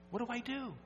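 high, soft, helpless question; lightly voiced girl begging for help; desperate girl's plea. Desperate girl asks pastor.

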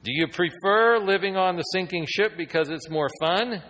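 high, loud pointed challenge; loud preacher's challenge; challenging question. Acted. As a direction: press the listeners hard.

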